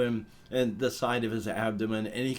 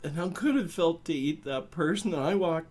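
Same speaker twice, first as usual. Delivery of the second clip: high, halting, emotional outburst; worked up and apologetic, pitch climbing, breaking off mid-phrase; upset and embarrassed; 6 semitones higher than usual. Acted. Hurt human confronting cryptid friends at the bar, high and emotional.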